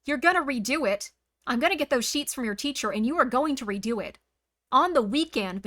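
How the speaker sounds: loud, hammed-up parental telling-off; mock scolding mother. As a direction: play the strict mom grounding her kid. Pretend mother scolding chat.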